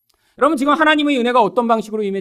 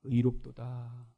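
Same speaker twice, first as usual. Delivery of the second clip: Saul's confession; 7 semitones lower than usual. Acted. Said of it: "다윗에게 이르되".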